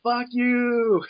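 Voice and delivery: drawn-out, high-pitched mock yell; high held cry, as if from the back; imitated yelling. Imitating Soap yelling from the back of the workplace, mock yell. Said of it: "you just hear soap just yelling".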